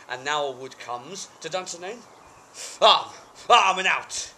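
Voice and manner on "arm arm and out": very loud, high shouted command; battle cry. Acted. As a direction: roar the troops to arms. King calls his men to arms.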